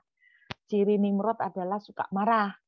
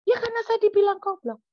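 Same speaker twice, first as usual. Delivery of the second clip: loud, high-pitched mock outrage; indignant retort; 13 semitones higher, 6 dB louder than usual. Preacher answers God about the insult, high indignant retort. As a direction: blurt back a wronged protest.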